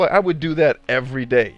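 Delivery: ordinary delivery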